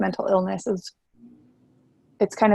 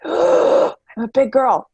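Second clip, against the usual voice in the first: slow, higher, louder little-girl voice, mock proud; playing a proud little kid; 6 semitones higher, 5 dB louder, much slower than usual. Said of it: "And then I got the L L C and I was like".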